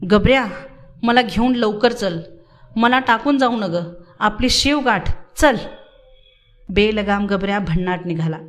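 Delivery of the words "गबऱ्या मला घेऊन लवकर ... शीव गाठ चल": ordinary delivery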